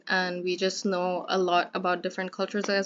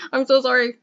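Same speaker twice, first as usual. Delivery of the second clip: laughing apology; 4 semitones higher, 7 dB louder than usual. Real, not acted. Apologizing after leaving for her mom.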